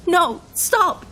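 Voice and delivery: high, loud protest; desperate screaming. Acted. Man is dragged back to his car, screaming. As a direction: scream as you are dragged away. Dragged away from girlfriend's body.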